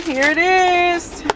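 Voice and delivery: high, slow, drawn-out reveal; slow, high show-off reveal; excited reveal. Real, not acted. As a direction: present it like a prize. Big reveal of the food.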